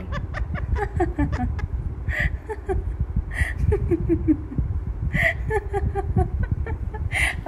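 high breathless laughing fit; genuinely cracking up; long wheezing real laugh; real laughter. Real, not acted. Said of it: "अब मैं सच में हंस रही हूँ".

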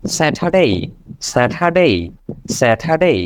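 Saturday quickly as one word, 'satha-day'. Shown as a demonstration. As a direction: run the syllables of Saturday together. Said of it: "first". (দ্রুত একসাথে 'স্যাঠাডে')

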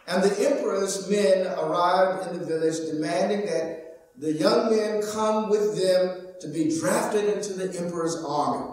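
ordinary talk, nothing marked

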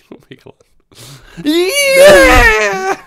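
squealing laugh, high pitched. Real, not acted. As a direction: burst out laughing mid-word. Cracking up at the remark.

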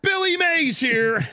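loud, high infomercial pitchman shout; Billy Mays. Retold or imitated. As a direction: yell it like a TV pitchman. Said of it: "You sound like an infomercial".

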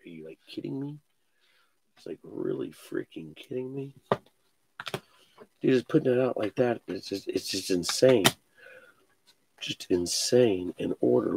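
hushed, breathy whisper of amazement; low near-whisper in disbelief; awed whisper. Real, not acted. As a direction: whisper it like you can't believe the pull. Hushed awe over card break.

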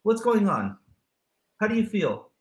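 lightly voiced loving papi; tender father. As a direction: coax the child gently. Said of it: "you go".

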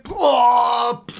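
startled shout. Real, not acted. Startled by the bird.

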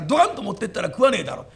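usual speaking voice

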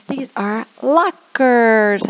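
slow, bright word modelling; fun teacher voice. Shown as a demonstration. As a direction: make it fun for small kids. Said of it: "I normally will make it a little bit more fun".

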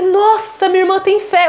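delight at the sister's faith; a touch of excited joy; happy. Retold or imitated. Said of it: "Eu fiquei tão feliz".

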